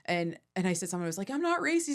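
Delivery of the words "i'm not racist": high-pitched protest; hammed-up indignant denial; mock defensive. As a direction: deny it in a squeaky voice. Retelling her protest on stage.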